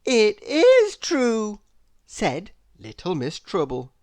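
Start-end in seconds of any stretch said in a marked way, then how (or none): 0.0-1.6 slow, high, loud insistence; insisting. A narrator's reading. Miss Trouble insisting to the crowd, slow and high. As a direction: drag it out knowingly.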